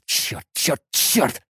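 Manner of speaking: whispering under the breath; whispered curse. Acted. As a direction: hiss the curses quietly to yourself. Hero curses to himself in thought.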